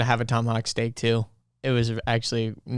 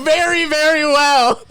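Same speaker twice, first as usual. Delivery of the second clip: laughing mock answer; 12 semitones higher, 7 dB louder, slower than usual. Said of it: "You go".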